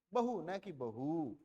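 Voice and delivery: बहु with a short u against बहू with a long uu, slowly. Shown as a demonstration. Teacher sets बहु against बहू, slowly, for the class. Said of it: "बहु क्या बोल रहे हैं".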